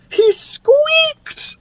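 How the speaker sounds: high squeal of wonder; delighted amazement; marveling. Acted. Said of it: "marveled Betty".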